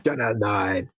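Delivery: Channel Nine impression. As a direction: imitate the comedy channel's jingle voice, low. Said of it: "the Channel Nine stuff on the Fast Show".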